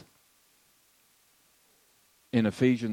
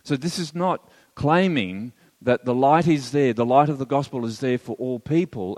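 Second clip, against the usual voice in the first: usual speaking voice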